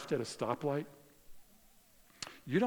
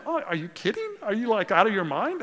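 incredulous outburst; 6 semitones higher, 6 dB louder than usual. Retold or imitated. Retelling outrage at the cop.

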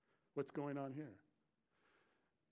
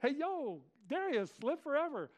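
high, loud casual shout-out; excited hail; 10 semitones higher, 5 dB louder than usual. Acted. Preacher playing Daniel hailing the king from the den, excited hail. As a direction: call out brightly to a buddy, pitched way up.

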